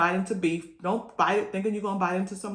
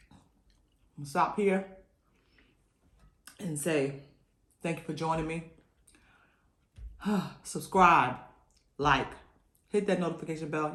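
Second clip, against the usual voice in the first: usual speaking voice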